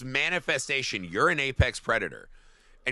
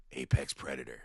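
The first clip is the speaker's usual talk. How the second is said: gruff dad; 12 semitones lower, 10 dB quieter than usual. Retold or imitated. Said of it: "And he goes".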